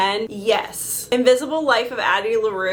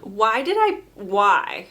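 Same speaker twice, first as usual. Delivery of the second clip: normal talking voice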